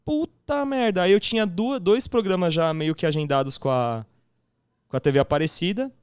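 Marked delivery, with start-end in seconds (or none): 0.1-1.0 amazed swear. Retold or imitated. Retells stunned joy.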